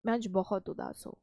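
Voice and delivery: low, hushed, downcast voice; very sad. Shown as a demonstration. Teacher shows expression matching sad words, soft and low sadness. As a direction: let the sadness sink the voice. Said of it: "जो शब्द है और जो facial expressions है".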